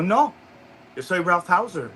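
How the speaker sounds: emphatic insistence. Retold or imitated. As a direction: insist on the name, hammed-up. Grandfather repeats name, insisting.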